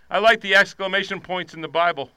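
ordinary talk, nothing marked

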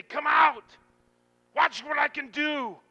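high, loud triumphant summons; shouted call. Acted. Jesus at your cave calling you out, loud shout. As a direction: burst into a commanding shout.

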